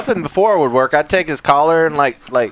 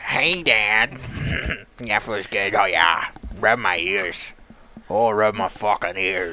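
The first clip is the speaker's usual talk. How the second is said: low, softer, blissed-out dog voice; Kano the dog's voice; 7 semitones lower, 7 dB quieter, slower than usual. Acted. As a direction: sink down low and savor the ear rub. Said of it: "we gotta come up with a voice for Kano".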